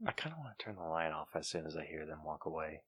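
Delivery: low, very soft murmur; hushed aside. Real, not acted. Host planning to turn the light off, speaking hushed. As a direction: keep it down until they've gone. Keeping low until callers walk away.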